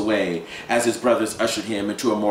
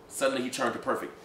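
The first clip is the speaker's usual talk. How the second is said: ordinary delivery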